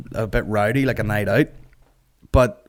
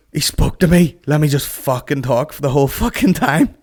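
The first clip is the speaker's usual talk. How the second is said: higher, louder, gushing mock drunk; over-eager pitched-up blurting; imitating excited heckler; 5 semitones higher, 5 dB louder than usual. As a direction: mimic a thrilled punter who got noticed.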